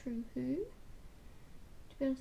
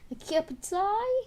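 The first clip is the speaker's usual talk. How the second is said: much higher, louder disbelieving repeat; high, loud, amazed question; incredulous; 9 semitones higher, 7 dB louder than usual. Real, not acted.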